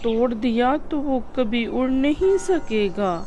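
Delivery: high, soft, tearful pleading; a mother near tears begging under her breath; high-pitched hushed distress, rising on each नहीं. Acted. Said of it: "असली माँ ज़ोर-ज़ोर से रोने लगी".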